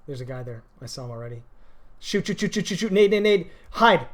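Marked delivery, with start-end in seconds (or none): 2.0-4.0 grenade warning yell. Real, not acted. Urgent callouts in firefight.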